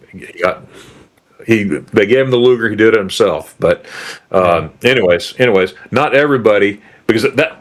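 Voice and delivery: ordinary talk, nothing marked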